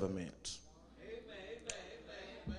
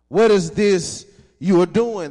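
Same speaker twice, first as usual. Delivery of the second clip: louder, higher mocking question; mocking challenge; 6 semitones higher, 12 dB louder than usual. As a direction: throw the taunt at them with scorn. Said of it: "they mocked and ridiculed us".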